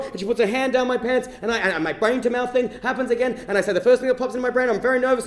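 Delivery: normal talking voice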